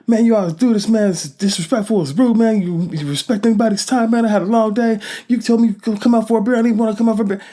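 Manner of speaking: high, loud furious tirade; angry rant. Retold or imitated. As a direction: let him have it, no pause for breath. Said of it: "I keep going off".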